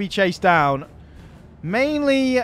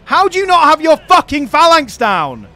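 louder, higher angry shout at his own units; furious yelling at troops; 6 semitones higher, 6 dB louder than usual. Real, not acted. Gamer yelling at his useless phalanx, furious shout. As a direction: bellow at the idiots on the field.

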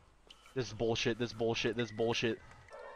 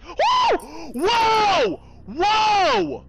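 shout; 9 dB louder, much slower than usual. Real, not acted. Startled shouts.

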